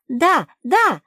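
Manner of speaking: high, loud eager shouts; excited cry. A narrator's reading. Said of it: "закричала Куки". (восторженный крик)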